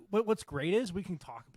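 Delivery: normal talking voice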